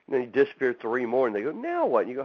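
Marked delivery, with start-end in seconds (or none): 1.5-2.0 much higher, puzzled "Now what?"; baffled now what. Retold or imitated. Friends asking again about his absence, high puzzled. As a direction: sound lost and incredulous.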